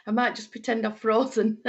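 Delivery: joking through real laughter; amused, with laughter round the words; laughing. Real, not acted. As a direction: chuckle into the words. Laughs at her own joke.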